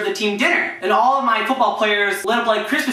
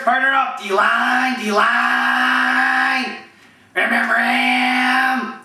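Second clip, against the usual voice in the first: Wolf Cool; 3 semitones higher, much slower than usual. Acted. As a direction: coach calling out drills, drawn-out shouts. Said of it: "There's Wolf Cool impressions".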